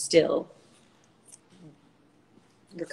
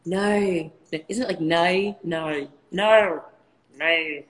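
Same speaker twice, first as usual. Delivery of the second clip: trying out an Australian "no"; Australian accent; 10 semitones lower than usual. Shown as a demonstration. Working out how friend Nat sounds, Australian accent. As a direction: stretch "no" into an Aussie diphthong. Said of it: "Australian accents".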